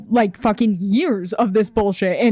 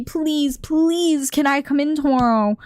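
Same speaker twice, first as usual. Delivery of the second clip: higher-pitched mock begging, falling on each please; mock pleading; 5 semitones higher, slower than usual. Vendor begging by phone to come in tomorrow, mock pleading. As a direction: imitate someone begging for a favor. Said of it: "Begs and pleads on the phone".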